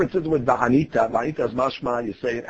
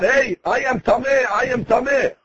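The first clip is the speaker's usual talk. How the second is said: mock public announcement; 7 semitones higher than usual. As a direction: imitate announcing it to passers-by. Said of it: "He has to announce to everybody".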